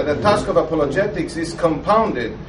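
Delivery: normal talking voice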